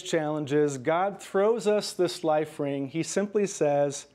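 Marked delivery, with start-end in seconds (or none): none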